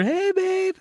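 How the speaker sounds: high, drawn-out call to get someone's attention; singsong long-distance holler, acted. Waving to wife across pool.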